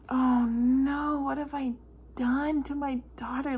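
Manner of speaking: mild mock wail of guilt; distressed. Mom reacting to nurse's warning, distressed. Anguished inner reaction, retold.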